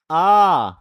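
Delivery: a loud, wide-open "आ" with the mouth fully open; a held, loud "आ" sound; open aa vowel. Shown as a demonstration. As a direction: open the mouth all the way and call out "आ". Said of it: "जोर से बोलोगे आ की मात्रा".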